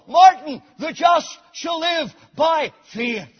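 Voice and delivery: very loud high call, then slowed on the verse; God's voice to Martin. Retold or imitated. God calling Martin Luther, booming and slow. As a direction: proclaim it like a voice from heaven. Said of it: "that word darted into his soul".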